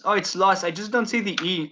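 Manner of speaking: ordinary talk, nothing marked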